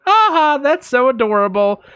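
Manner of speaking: high mock cooing; laugh, then gush in a high put-on voice; gushing cat owner. Neighbor finds her cat's misbehavior adorable, high gushing imitation.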